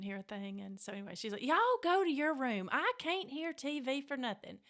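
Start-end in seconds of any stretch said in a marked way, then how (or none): 1.5-4.6 high, loud exasperated order; imitating a fed-up mother; scolding mama. Mother sending bored kids to their room, loud and fed up.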